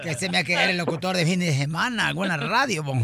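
put-on regional character. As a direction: tease the caller in a low put-on voice. Host continues low character voice. (voz grave de personaje)

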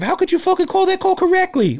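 ranting at the referee in a mocking high voice; mock outraged yell. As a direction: screech it like a bratty player yelling at the ref. Mocking yelling at the ref.

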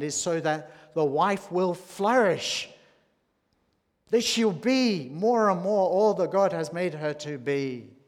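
loud, high, building emphatic proclamation; preaching climax. Real, not acted. Preacher to the congregation, rising to a loud emphatic peak. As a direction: drive the point home with full force.

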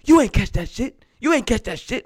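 high, mocking taunt from the sideline; heckling coach. Coach taunting a rookie receiver, high mock jeer. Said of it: "And I hear someone like".

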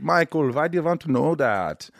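puzzled German, pitched a bit up; German driver. Retold or imitated. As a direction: question it in a German accent. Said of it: "this guy in Germany".

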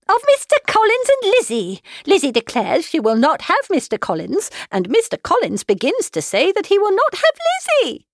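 very high-pitched, exasperated wail; flustered mother spelling out the crisis; agitated, piercing complaint; frantic. A narrator's reading. Frantic wife explains to husband.

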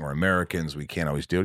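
usual speaking voice

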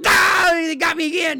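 high, loud startled cry of getting caught; mock scared yelp. Dad playing with daughter, yelping in mock fright. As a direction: yelp like the neighbor just grabbed you.

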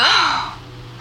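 sharp high gasp of shock; shocked intake of breath; startled gasp. Acted. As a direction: catch your breath in fright. Friend suddenly reappears.

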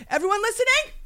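high, theatrical storming-out; indignant parting outburst; raging out. Pretend. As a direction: announce it to the room, then curse. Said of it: "raging out in my mind".

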